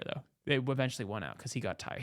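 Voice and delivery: usual speaking voice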